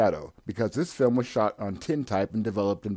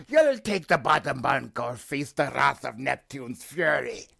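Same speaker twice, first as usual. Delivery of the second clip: put-on higher, louder menacing old salt; threatening sea captain; 6 semitones higher, 4 dB louder than usual. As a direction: bellow a pirate's threat.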